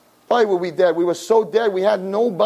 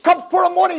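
normal talking voice

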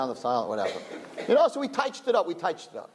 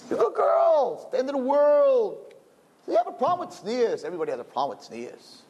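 high, soothing, patronizing voice; the guf's voice; 12 semitones higher than usual. Retold or imitated. The body voice reassuring the girl she's fine, high and coaxing. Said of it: "says the guf".

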